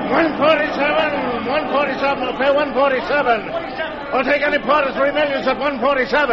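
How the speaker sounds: high, carrying trader's call over the floor; calling out bids. Acted. Trent calling bids on the gold room floor, high and carrying. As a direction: cry the price across the room.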